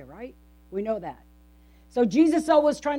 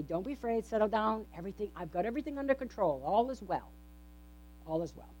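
reassuring Jesus; 2 semitones lower, 10 dB quieter, faster than usual. Acted. Jesus voiced calming frightened disciples.

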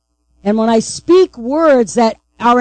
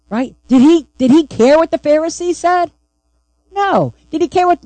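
usual speaking voice

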